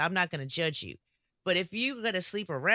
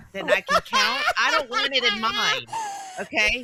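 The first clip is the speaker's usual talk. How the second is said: laughing; 6 dB louder than usual. Real, not acted. Laughing punchline about promiscuous men.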